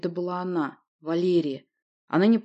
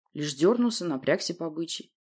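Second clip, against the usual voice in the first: ordinary delivery, nothing marked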